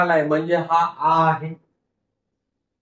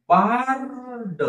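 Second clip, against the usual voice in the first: Bard stretched out slowly, sound by sound; slow blending; 5 semitones higher, much slower than usual. Shown as a demonstration. Teacher blending a word slowly for pupils. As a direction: draw out bard for the class. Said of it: "हळूहळू सावकाश म्हणून दाखवत आहे".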